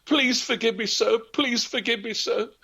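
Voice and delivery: very high, desperate pleading; girl begging the peddler for mercy, very high; begging forgiveness. A narrator's reading. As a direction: plead on your knees, voice up high. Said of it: "begged and begged forgiveness".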